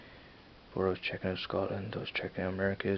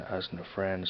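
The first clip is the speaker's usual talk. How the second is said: ordinary delivery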